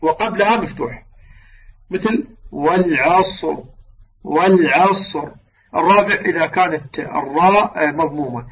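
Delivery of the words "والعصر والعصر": slow "والعصر", ending on a thick, vowelless ra; heavy final ra. Shown as a demonstration. Teacher gives an example of the third case, heavy ra. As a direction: draw out "والعصر" and close on a heavy ra. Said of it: "الحالة الثالثة إذا سكنت الراء وقبلها ساكن".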